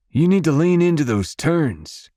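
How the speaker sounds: high, lifted coaching call; instructing. A narrator's reading. Dad instructing his grandson on riding, instructing. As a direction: call out advice to a young rider. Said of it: "Dad instructs Ace".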